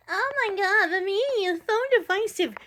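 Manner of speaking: high-pitched mocking whine; mock whinging. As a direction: mimic an overdramatic complainer. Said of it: "You wanna whinge about".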